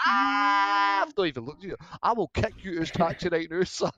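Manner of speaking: loud, furious threat from the driver; angry taxi driver. Retold or imitated. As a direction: shout the threat at a careless passenger. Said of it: "I was like".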